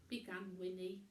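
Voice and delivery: usual speaking voice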